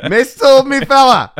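loud mock-detective grilling with a laugh breaking through; hammed-up copper, laughing as he nails it; Line of Duty interrogator. Teacher cornering a lying kid, laughing mock interrogation. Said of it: "closest to Line of Duty I'm ever gonna get".

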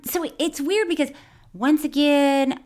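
usual speaking voice

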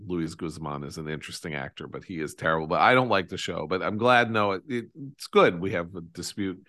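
usual speaking voice

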